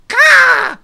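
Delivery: high, very loud imitated bird caw; loud crow caw. Player voicing Russell the bird, loud caw. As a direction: squawk it out like a crow.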